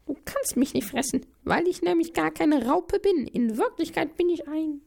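high squeaky cry to stop; noodle shouting. Acted. Noodle stops the owl from eating it, high and squeaky. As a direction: squeal desperately.

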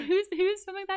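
high, sharp startled ask; high surprised who's filming that; startled question. Retold or imitated. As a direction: blurt it out, caught off guard. Retold startled question on set.

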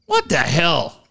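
ordinary delivery

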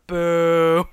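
loud booing heckle; loud mock boo. Writer walking by booing the dumb line, loud. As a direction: boo them off like a fed-up writer. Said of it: "a writer to walk by and go".

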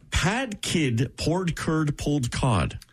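careful slow demo; very slow twister. Shown as a demonstration. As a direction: drag it out slowly. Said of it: "MIT claims this one is tougher".

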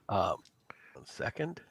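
ordinary delivery

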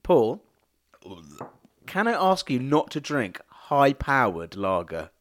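normal talking voice

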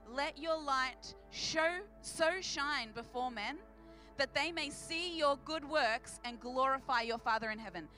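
ordinary delivery

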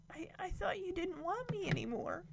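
put-on small, high, soft little-girl voice; imitated hurt child. Voicing the girl to her mom, imitated hurt child.

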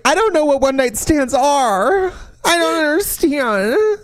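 high hammed-up baby voice; mock naive. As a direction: play dumb, whimpering.

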